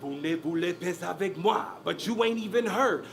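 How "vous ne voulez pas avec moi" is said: put-on French accent, smooth delivery; speaking French. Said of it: "Pardon my French accent".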